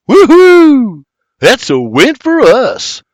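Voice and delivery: very high, loud victory whoop; triumphant shout. A narrator's reading. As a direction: celebrate with a fist pump. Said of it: "William shouts".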